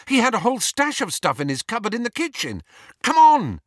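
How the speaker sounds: high, fast, rising to a loud shout; excited urging. A narrator's reading. Harry rallying his friends to the kitchen, urgent and excited. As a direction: rattle it out, then yell to get them moving.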